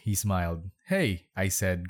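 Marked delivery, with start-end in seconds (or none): 0.9-1.3 higher, mildly disgusted greeting; grossed out. A narrator's reading. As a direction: greet him with a touch of disgust. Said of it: "grossed out".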